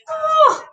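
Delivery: high strained groan of effort; a sudden high yelp from sore arms; pained oh. Real, not acted. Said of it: "I'm gonna need that break".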